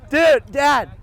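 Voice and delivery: shouting to dad; loud excited call. Real, not acted. Calling out to dad.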